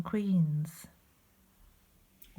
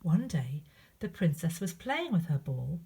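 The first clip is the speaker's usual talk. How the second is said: plain reading voice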